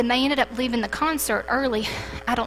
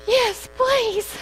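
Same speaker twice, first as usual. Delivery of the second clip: eager woman; 12 semitones higher than usual. Retold or imitated. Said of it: "And she's like".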